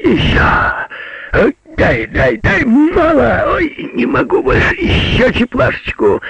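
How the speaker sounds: high, frantic yelling; overlapping cries. A narrator's reading. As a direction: shout wildly in the steam. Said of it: "слышны были только крики". (истошные выкрики)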